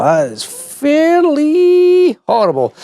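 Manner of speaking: high, slow, drawn-out mock disgust; mock horror. Real, not acted. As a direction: stretch it out in playful horror. Reacting to the ugly colour.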